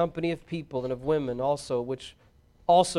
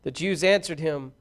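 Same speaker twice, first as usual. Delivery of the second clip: usual speaking voice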